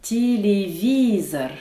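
"Téléviseur" in Russian, slowly, ti-li-VI-zor; first two vowels as i, stress the VI of "Téléviseur"; unstressed yé as i. Shown as a demonstration. Teacher showing the second rule, "Téléviseur" said ti-li-VI-zor. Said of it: "les deux yé qui le précèdent deviennent des i".

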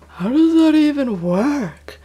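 loud, high, drawn-out yell; sleep-yelling. Retold or imitated. Waking up under pressure on the boat, imitated groggy yelling. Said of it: "I start, like, yelling stuff".

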